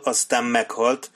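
ordinary delivery, nothing marked

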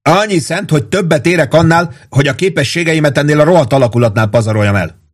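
high-pitched angry retort; heated, aggrieved outburst; yelling back. A narrator's reading. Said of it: "ordított rá Warden".

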